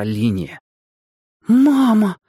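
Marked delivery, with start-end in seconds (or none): 1.5-2.2 high-pitched startled exclamation; a girl gasping for her mum, voice jumping up; gasped cry. A narrator's reading. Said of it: "ахнула Света".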